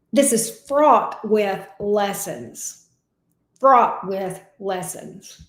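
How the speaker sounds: slow, weighty, pressing on fraught; slow emphatic stress. Real, not acted. Tarot reader to Capricorn, slow and emphatic. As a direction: lean hard on "fraught", drawn out slowly.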